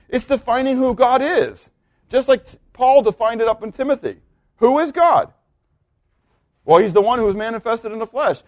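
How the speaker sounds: high-pitched, insistent sermon delivery; emphatic preaching. Real, not acted. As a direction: drive through the list like a fervent preacher. Preaches at full force.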